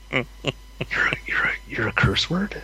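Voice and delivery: hushed, halting disbelief; puzzled question under the breath; baffled near-whisper. Retold or imitated. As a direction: stammer it softly, taken aback. Retold baffled reaction to classmate.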